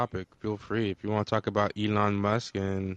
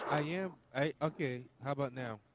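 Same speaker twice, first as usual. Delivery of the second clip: far from mic; 3 semitones higher, 10 dB quieter than usual. Real, not acted. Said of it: "you sound like you're far away".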